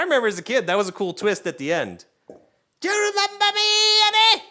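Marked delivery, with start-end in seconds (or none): 2.8-4.4 high, loud, menacing villain; villain impression. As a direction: play the reveal with a creepy high voice. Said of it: "quoting movie villain".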